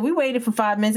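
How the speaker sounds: ordinary delivery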